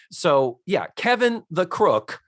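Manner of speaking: ordinary delivery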